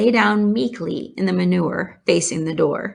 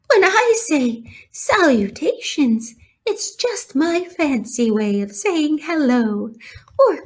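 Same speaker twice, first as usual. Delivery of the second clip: lightly higher, gracious spider voice; kindly spider; 7 semitones higher than usual. Acted. Charlotte introducing herself to Wilbur, kindly voice. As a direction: explain with gentle amusement.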